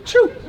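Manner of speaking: high pretend sneeze; forced achoo; fake sneeze.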